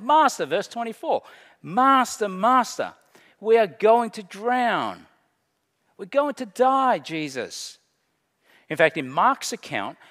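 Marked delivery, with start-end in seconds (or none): 0.0-0.4 much higher and louder cry; desperate shout. Acted. Disciples shouting to sleeping Jesus in the storm, desperate. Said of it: "They shouted". 1.6-7.8 high, loud cries of panic; desperate shout. Acted. Drowning disciples cry out to sleeping Jesus, desperate. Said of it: "They cried out in desperation".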